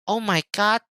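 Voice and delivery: high, dismissive mock mom voice; imitating mom. Mimicking mom ordering him out of her room, high annoyed voice. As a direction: wave him off like an annoyed mother. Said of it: "she's like".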